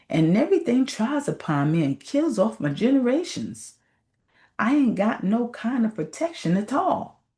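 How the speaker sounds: a lowly snake grumbling its troubles to God; complaining snake. Acted.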